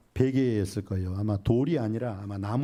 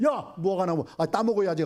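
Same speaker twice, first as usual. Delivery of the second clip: eager exclamation; 6 semitones higher than usual. Acted. Hungry Jesus spots a fig tree.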